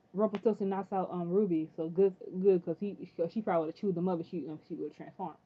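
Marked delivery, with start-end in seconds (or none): none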